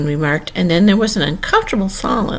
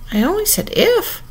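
very high, small pleading voice; piteous pleading; 14 semitones higher than usual. A narrator's reading. Alice defending herself to the queens, piteously. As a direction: plead pitifully, pitch way up. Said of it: "poor Alice pleaded in a piteous tone".

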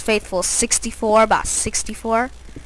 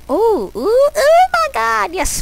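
very high, loud squeals of delight; excited squeal; 14 semitones higher, 5 dB louder, slower than usual. Real, not acted. Gamer spotting food in the chest, squealing very high. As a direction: squeal with sudden joy.

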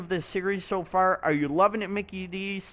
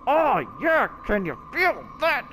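hyped sign-off; 6 semitones higher than usual. Real, not acted.

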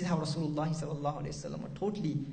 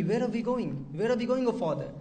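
put-on girl's anxious question; 5 semitones higher, 3 dB louder than usual. Daughter asks father on journey.

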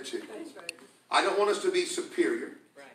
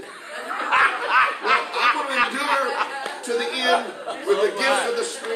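higher, louder revival preaching; full force preaching; 7 semitones higher, 6 dB louder than usual. Acted. Pastor fires up the congregation, preaching louder and higher.

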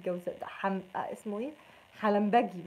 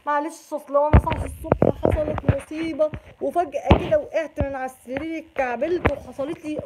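louder, put-on excuse-making voice; mock excuse; 5 semitones higher, 8 dB louder than usual. Gemini friend explaining why they're late, mock excuse. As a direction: act out the lame excuse.

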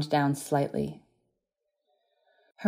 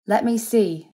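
high, louder insistence; pressing; 6 semitones higher, 5 dB louder, slower than usual. A narrator's reading. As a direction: press the request, raised and urgent. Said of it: "she pressed".

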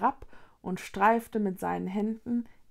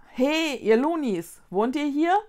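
calling out; 5 semitones higher, 5 dB louder than usual. A narrator's reading. Vicky passes the question to the Loonies, calling out.

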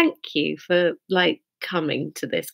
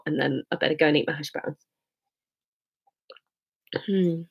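usual speaking voice

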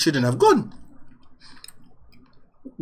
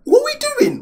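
very high, loud squeal of disbelief; incredulous question, voice shooting way up; exasperated disbelief; 5 dB louder than usual. Real, not acted. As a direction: protest in a high-pitched, baffled yelp. Exasperated reaction to the video.

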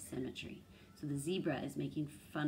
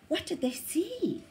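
suspenseful question; 13 semitones higher, 5 dB louder than usual. A narrator's reading. Suspense question to young listener.

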